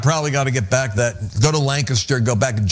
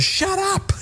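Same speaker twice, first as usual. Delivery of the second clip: mock yell. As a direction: imitate her yelling in a high, held-back voice. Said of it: "She's yelling at him".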